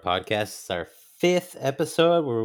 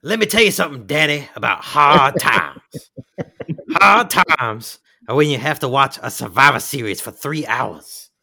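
wrestling promo; 3 semitones higher, 6 dB louder than usual. Pretend. Mock wrestling promo reply.

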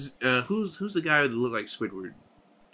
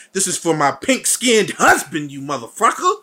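mock vengeful outburst; 5 semitones higher, 5 dB louder than usual. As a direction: play up the avenging wife. Acts out Scarlet Witch's vengeful line.

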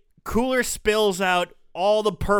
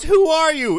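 shocked cry; 9 semitones higher, 5 dB louder than usual. Retold or imitated. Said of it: "She goes".

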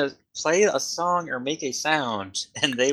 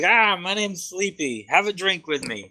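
pirate voice; 7 semitones higher than usual. Acted. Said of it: "He's back to the pirate".

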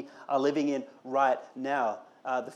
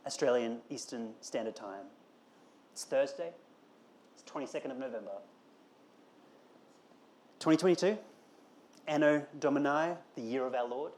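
a hint of a dry, over-precise friend reading out the time, softer and lower; pedantic best man; 4 semitones lower, 5 dB quieter than usual. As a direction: lightly mimic a mate listing every detail of the date. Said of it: "the best man comes to him and says".

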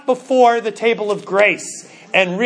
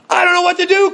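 high, breathless giddy cry; laughing and crying; 8 semitones higher, 3 dB louder, faster than usual. Acted. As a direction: blurt it out half laughing. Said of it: "cried Scrooge, laughing, crying".

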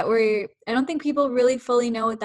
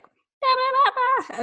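high-pitched mock yell of nonsense; imitated yelling; slower than usual. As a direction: act out calling from another room, much higher. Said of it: "I'll yell and be like".

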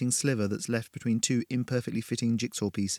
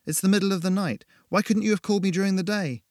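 exasperated; 8 semitones higher, 3 dB louder than usual. A narrator's reading. Irritated, scared at night.

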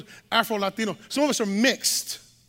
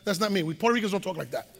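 quick aside; 5 semitones lower, 6 dB quieter, much faster than usual. Real, not acted. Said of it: "we talk fast".

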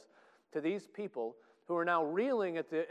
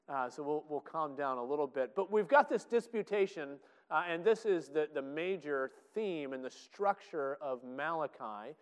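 ordinary delivery

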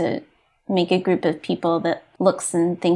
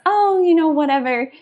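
dismissive; 7 semitones higher, 3 dB louder than usual. Retold or imitated. Dismissive quote of old self.

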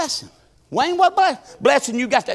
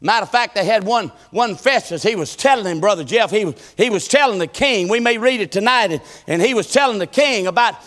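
ordinary talk

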